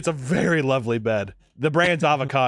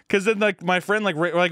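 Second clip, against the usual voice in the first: ordinary talk, nothing marked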